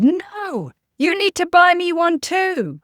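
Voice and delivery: put-on high, whiny child insisting on a toy; demanding little girl. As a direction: play the four-year-old digging in her heels. Retold daughter demanding in shop.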